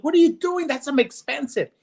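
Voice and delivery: high, frantic mock outrage; American losing his mind. Joke's American cowboy protesting wasted tequila, high and frantic. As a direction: jump up high in disbelief. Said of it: "he loses his mind".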